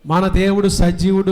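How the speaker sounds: said loudly. Shown as a demonstration. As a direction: proclaim it loudly to the congregation. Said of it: "చెప్పాలి గట్టిగా". (గట్టిగా ప్రకటించడం)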